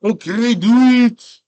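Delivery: loud, high, bad Conan accent; hammed-up Conan accent; attempted Conan accent. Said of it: "I can't do his accent".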